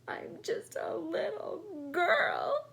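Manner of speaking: high, slow, tearful plea; sobbing little girl. Acted. Natasha sobs to the doll, slow and tearful. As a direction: sob it out like a worn-out child. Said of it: "she said between sobs".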